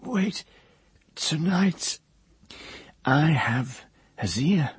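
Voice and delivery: sudden high stammered cry. A narrator's reading. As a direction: blurt it out, stammering. Hare's voice.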